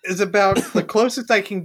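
slow, laughing through words. Real, not acted. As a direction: struggle to talk through laughter.

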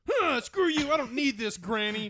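put-on high, loud teenage outburst; bratty yell. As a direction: shout it back like a sulky kid storming off. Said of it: "And he's like".

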